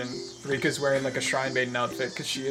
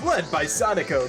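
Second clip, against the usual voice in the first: normal talking voice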